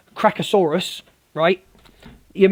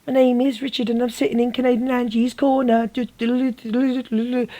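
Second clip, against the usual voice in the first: higher, put-on mimic voice trailing into do-do-do; mocking sing-song mimicry; 6 semitones higher, 4 dB quieter than usual. Streamer mocks Richard sitting in Angie's corner, sing-song mimicry. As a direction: parody the clip in a raised voice. Mimics clip again, adds a jingle.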